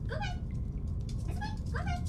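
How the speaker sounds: very high soft coaxing; high pet-talk. Real, not acted. Owner telling her dog to go to bed in high soft baby talk. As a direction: send the dog gently to bed.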